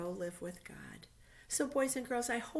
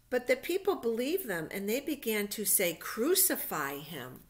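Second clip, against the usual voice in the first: reading a Bible story aloud, louder and measured; story narration; 3 semitones higher, 6 dB louder than usual. A narrator's reading. Teacher reading Easter story to children, storybook narration. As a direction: tell it like a picture-book story to little ones.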